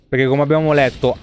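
ordinary talk, nothing marked

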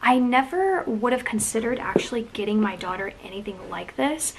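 normal talking voice

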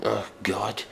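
ordinary talk, nothing marked

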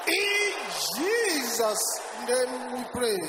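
normal talking voice

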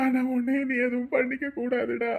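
usual speaking voice

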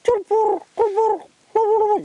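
very high trilled call; bird call. Shown as a demonstration. Showing the Joel Borman's song, high bird call. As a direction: imitate the bird's high burbling cry. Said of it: "Joel Borman".

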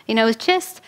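normal talking voice

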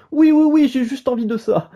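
high, loud eager yes; eager excited. Real, not acted. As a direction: burst out keen to play. Eager to start the game.

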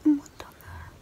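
hushed whisper, mum asleep nearby. Real, not acted. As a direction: keep it to a whisper so nobody wakes. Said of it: "I have to whisper 'cause my mum's asleep".

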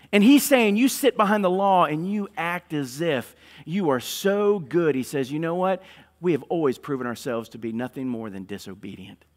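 high, loud, impassioned preaching of Stephen's rebuke to the council; preaching at full force. Acted. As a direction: thunder it from the pulpit.

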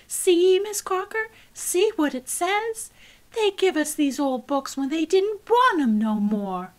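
urgent pleading. Acted. Cassie holding up the book to her teacher, urgent pleading.

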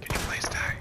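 whispered polite request; mock ASMR whisper. Player asks the monster to die, whispering. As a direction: whisper it sweetly at the enemy. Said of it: "If you guys like the A S M R".

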